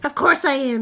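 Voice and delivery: loud, indignant monster snap; touchy girl monster retort; snapped. A narrator's reading. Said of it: "she snapped".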